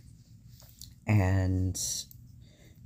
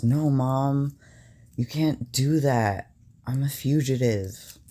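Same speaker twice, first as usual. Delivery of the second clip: higher, louder pleading as the son; retold agitated objection, pitched up; imitated urgent protest; 5 semitones higher, 4 dB louder than usual. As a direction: act out a son frantically stopping his mom. Acted son's panicked protest to mom.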